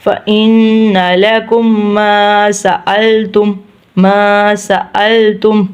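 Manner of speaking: slow, nasal held n in "فإن"; ghunna recitation. Shown as a demonstration. Teacher demonstrating ghunna, slow elongated recitation. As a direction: hum the n, stretch "ما" in "ما سألتم". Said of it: "ادھر گننا کریں گے".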